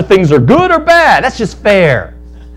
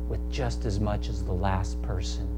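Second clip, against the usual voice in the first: much softer, lower, calm reply; gentle owner; 8 semitones lower, 11 dB quieter than usual. Acted. Vineyard owner calmly answers the grumbling worker, soft and low. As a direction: answer the complaint gently and firmly.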